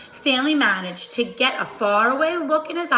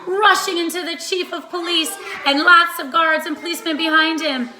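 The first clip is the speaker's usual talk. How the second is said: usual speaking voice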